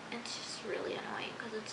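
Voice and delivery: fed-up whisper; soft, voiceless complaint; whispered annoyance at the cops. Real, not acted. Venting about the cops.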